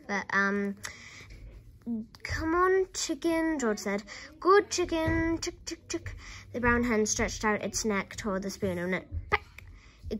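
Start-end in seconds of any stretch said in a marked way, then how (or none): 2.2-3.6 high, louder hen call; coaxing. A narrator's reading. George beckons the hen to the spoon, coaxing. 4.4-6.2 coaxing. A narrator's reading. George praises the hen at the spoon, coaxing.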